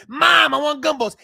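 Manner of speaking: put-on high, loud demanding child; bratty kid yell. Spoiled kid yelling at mom for gumballs. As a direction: shout it like a spoiled brat.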